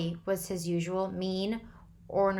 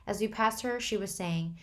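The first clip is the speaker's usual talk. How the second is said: ordinary delivery, nothing marked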